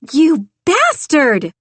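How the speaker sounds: loud, high, slow furious yell; drenched woman roars at the man laughing; roared. A narrator's reading. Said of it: "she roared".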